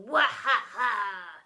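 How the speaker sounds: slow, loud villain laugh; evil cackle. A narrator's reading. Doctor Dredd gloating over victim, evil cackle. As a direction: cackle with glee. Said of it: "an evil cackle".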